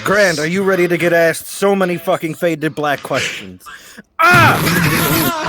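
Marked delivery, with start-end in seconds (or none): 4.2-5.5 sudden yell. Real, not acted. Host yell after his joke.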